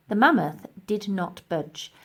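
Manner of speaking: plain reading voice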